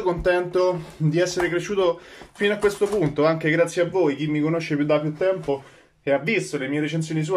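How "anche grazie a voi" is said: ordinary talk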